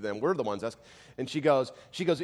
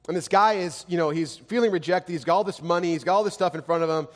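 raised, louder preaching voice that presses each question; preaching at full force; 6 semitones higher, 8 dB louder than usual. Acted. Pastor urges the listeners with fervent, raised preaching.

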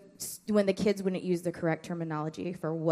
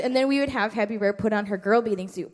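ordinary talk

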